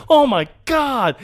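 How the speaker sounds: loud, high, drawn-out disbelief; hammed-up exasperated cry; mock exasperation. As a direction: wail it at someone hopelessly gullible. Acted outburst at opponent's gullibility.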